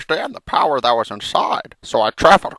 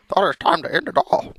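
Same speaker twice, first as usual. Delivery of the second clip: ordinary talk, nothing marked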